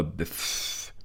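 long airy "pff" through pushed-out lips; imitated scornful raspberry; dismissive lip noise. A narrator's reading. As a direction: blow a drawn-out puff of dismissal. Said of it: "مط شفتيه وأصدر صوتا خاصا جدًا".

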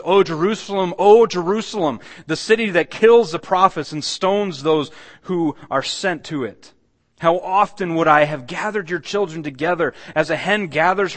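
loud, high cry of grief over the city; anguished lament. Acted. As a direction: wail it out like a heartbroken prophet. Voicing Jesus' lament over Jerusalem.

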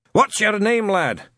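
put-on loud, barking old Scot; gruff old sergeant. Shadwell questioning Newton, gruff and loud. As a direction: bark the question.

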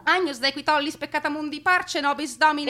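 fast, high, loud gabbled Agnus Dei; terrified woman rattling off the litany; frantic prayer. Acted. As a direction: pray in desperate rush. Frantic prayer during sinking.

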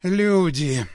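breathy sighing word; long sighing rustle. A narrator's reading. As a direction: sigh it out like rustling leaves. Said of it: "долгим вздохом прошелестела Люба".

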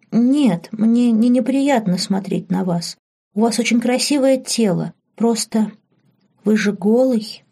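put-on timid young girl. Embarrassed girl explains herself to her undressed master, shyly.

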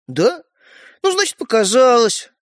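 high, forceful show of surprise; feigned innocence. Acted. Brother covering his trick on Стёпа, feigned innocence.

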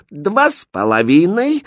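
high, slow, composed count; coolly drawn-out count; unperturbed. A narrator's reading. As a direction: count on, unruffled. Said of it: "с невозмутимым видом сказала".

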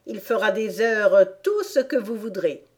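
plain reading voice

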